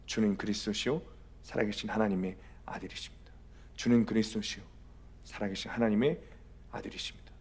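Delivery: hushed, low profession of faith; solemn quiet declaration, trailing into near-whisper; retold confession. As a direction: confess softly, one phrase at a time. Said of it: "여기저기 고백을 하며".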